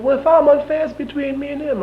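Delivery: very high mock-sheriff voice; imitating Sheriff Tyson. Sheriff answers the charge of unfairness, high-pitched imitation. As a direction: push the imitation right up high, starting loud. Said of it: "He said".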